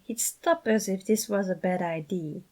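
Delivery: plain reading voice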